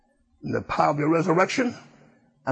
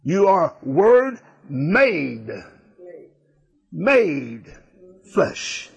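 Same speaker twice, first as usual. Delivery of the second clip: You are Word made, slow, then flesh breathed; hammering the point home slowly; slow emphasis; much slower than usual. Real, not acted. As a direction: drag it out for weight. Preacher repeats for emphasis.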